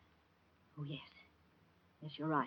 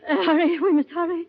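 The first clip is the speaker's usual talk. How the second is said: frantic crying; 11 semitones higher, 6 dB louder than usual. Acted. Woman frantic, crying heard.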